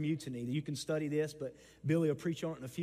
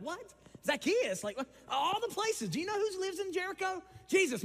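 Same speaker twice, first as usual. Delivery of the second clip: high, incredulous what; shocked disbelief; 14 semitones higher, 4 dB louder than usual. Retold or imitated. Disciples react to Zacchaeus, high shocked disbelief.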